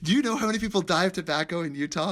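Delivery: quoted chief. Retold or imitated. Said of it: "he goes".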